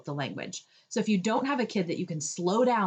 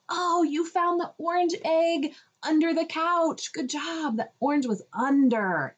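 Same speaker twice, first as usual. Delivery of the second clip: higher, slow, excited praise stressing words; emphatic praise; 9 semitones higher than usual. Shown as a demonstration. Praising a toddler's find, slow excited emphasis. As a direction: cheer the child and lean on orange and under. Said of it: "I emphasize and repeat the words".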